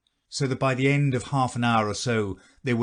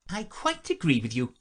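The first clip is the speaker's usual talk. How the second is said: Duchess voice; 6 semitones higher than usual. A narrator's reading.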